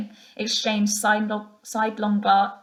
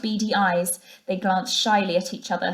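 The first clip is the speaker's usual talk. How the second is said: plain reading voice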